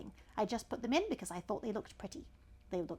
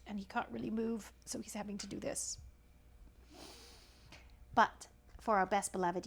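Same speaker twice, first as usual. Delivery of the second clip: usual speaking voice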